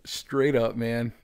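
ordinary delivery

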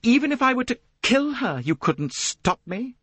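high, emphatic dare; defiant. A narrator's reading. Jacqueline daring Poirot over killing her rival, defiant.

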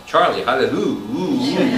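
slow, loud angelic welcome; drawn-out loud angel voice; angel's greeting. Retold or imitated. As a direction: greet him warmly like an angel at the gate. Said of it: "the angel said".

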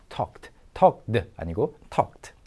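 talked with a crisp T ending, not a D; talked ending in a clean T sound. Shown as a demonstration. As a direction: say talked as tɔkt, final T unvoiced. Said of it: "발음은 T 발음이 됩니다".

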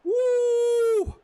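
huge high whoop of joy; goal cheer. Real, not acted. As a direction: let out a wild goal whoop. Scoring a goal in game.

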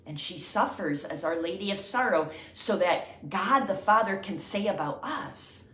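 usual speaking voice

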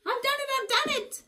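high, gleeful witch triumph; gleeful cry. Acted. Zildy thrilled after transforming the dog, gleeful and high. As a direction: crow with delight at the spell. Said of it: "Zildy cried gleefully".

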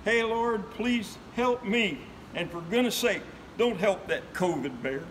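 rhythmic storyteller's recital with lively swings; folksy poem recitation. Acted. Reciting the preacher poem to friends, rhythmic and lively. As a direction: perform the old preacher ballad for listeners. Recites his added covid verse.

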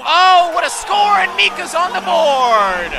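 loud, high celebratory call; triumphant play-by-play roar; excited goal shout. Real, not acted. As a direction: belt out the scorer like an announcer. Calling own goal in game.

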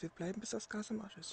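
soft repeated radio call. Pretend. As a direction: repeat it under your breath. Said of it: "Ich wiederhole". (leise wiederholt)

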